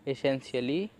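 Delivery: "essentially" opening on an ay sound, ay-SEN-shuh-lee; starting with "e". Shown as a demonstration. Teacher showing learners the other variant, "essentially" begun with an ए sound. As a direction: say "essentially" with ए as the first vowel. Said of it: "ज़्यादातर लोग ए ही बोलते हैं".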